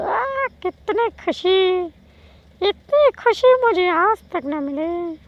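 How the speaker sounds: very high, slow, hammed-up Hindi film line; Hindi meme voice. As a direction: ham up an overjoyed filmi quote. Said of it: "আমি মিমসও লাগাবো". (উঁচু গলায় হিন্দি মিম নকল)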